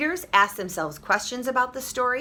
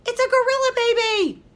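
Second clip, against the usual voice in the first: high, loud gasp of discovery; shocked exclamation; 6 dB louder than usual. A narrator's reading. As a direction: announce the shocking surprise. Shocked discovery in read-aloud.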